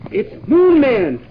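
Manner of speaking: loud, slow, alarmed cry of warning; bellowing that the monster has arrived; alarmed shout. Pretend. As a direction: shout the warning across the whole crew. Kids' space adventure, alarm at Moon Man.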